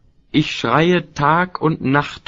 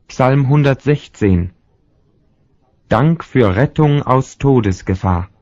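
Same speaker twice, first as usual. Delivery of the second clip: louder, announcing the psalm title; heading announcement; 4 dB louder than usual. Acted. Reader announcing the next psalm heading, louder. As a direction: set the heading apart like a title card.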